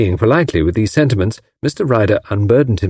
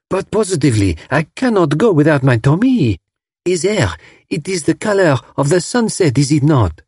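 put-on lilting French-accented English, pitched up; 6 semitones higher than usual. A narrator's reading. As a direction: coo it like a charming Frenchwoman in broken English. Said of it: "Her dainty broken English was fascinating".

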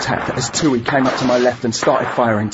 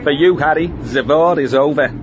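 mock war film line. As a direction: deliver it like a film villain. Joking war-film line, laughter after.

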